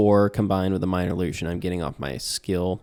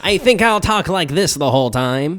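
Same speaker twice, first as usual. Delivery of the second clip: high, loud cartoon gnome voice; cartoonish gnome; 8 semitones higher, 8 dB louder than usual. Player showing his character's voice to the host, cartoonish. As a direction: put on a comical high gnome voice. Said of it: "talk in a cartoonish voice".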